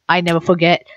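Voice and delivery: ordinary talk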